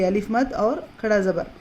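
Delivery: ordinary talk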